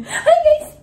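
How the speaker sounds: very high squeaky "Hi guys"; baby-talk greeting. Shown as a demonstration. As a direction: pitch it way up for the baby. Coaching baby. (napakataas na "Hi guys")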